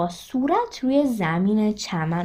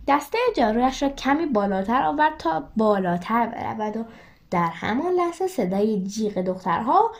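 ordinary delivery

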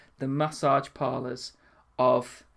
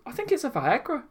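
mum's voice; 10 semitones higher than usual. Retold or imitated. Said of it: "She goes".

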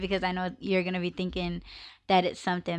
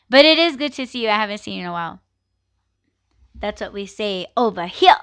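normal talking voice

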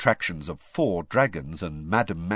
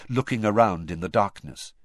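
usual speaking voice

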